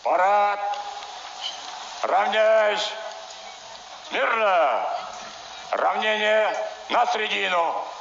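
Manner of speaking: shouted commands. Real, not acted. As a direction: call the parade to attention.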